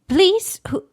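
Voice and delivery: high-pitched pleading please. Acted. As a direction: beg with a sharp lift in pitch. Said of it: "with a plea".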